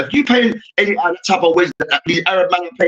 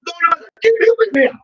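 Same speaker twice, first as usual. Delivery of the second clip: much higher and rushed pleading; Turkish player impression; 11 semitones higher, much faster than usual. Turkish player begging to come on, much higher and rushed. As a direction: gabble a frantic plea to get on. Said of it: "Man, like this".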